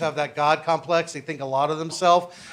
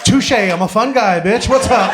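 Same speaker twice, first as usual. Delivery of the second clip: cocky punchline; 7 dB louder than usual. Real, not acted. Punchline swagger.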